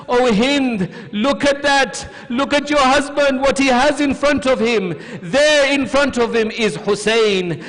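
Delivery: high, loud, urgent grief; high-pitched pleading, easing slightly at the end; impassioned lament. Retold or imitated. Preacher voices Zainab's anguished answer.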